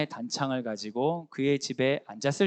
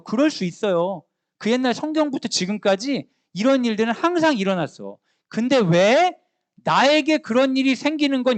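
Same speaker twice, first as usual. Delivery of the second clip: higher and louder, pressing challenge to the pews; preaching at full force; 6 semitones higher, 6 dB louder than usual. Acted. Preacher challenging the congregation, at full force. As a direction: drive the question home like a pulpit appeal.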